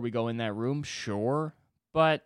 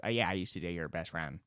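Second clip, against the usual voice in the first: lower, softer laid-back guy; 6 semitones lower, 5 dB quieter than usual. Retold or imitated. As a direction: lightly mimic a too-cool dude. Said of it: "'cause he's one of those".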